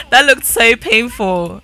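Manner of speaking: loud, slow, drawn-out reaction; amused exclamation. Real, not acted. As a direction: exclaim it loudly, stretching the words. Laughing reaction to a failed flip.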